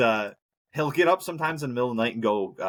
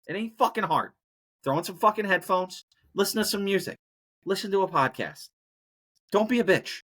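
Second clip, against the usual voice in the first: higher-pitched exasperated rant; 7 semitones higher than usual. Real, not acted. Vlogger scolds No Mow May people, exasperated rant. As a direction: tear into them, fed up.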